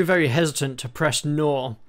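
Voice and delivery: ordinary delivery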